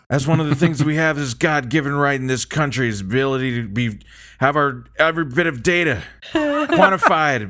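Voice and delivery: put-on sleepy old politician with halting phrases; mock presidential ramble, drowsy and halting; Sleepy Biden. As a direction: drift through it, pausing between phrases. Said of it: "as, uh, Sleepy Biden".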